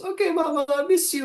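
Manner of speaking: put-on very high, plaintive girl; small high voice, missing Mama; young daughter. Crying daughter saying goodbye to Mama, high plaintive voice.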